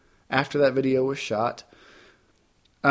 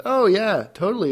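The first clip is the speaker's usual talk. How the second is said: bright, higher eager yes; eager reply; 7 semitones higher than usual. Retold or imitated. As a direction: jump at the offer.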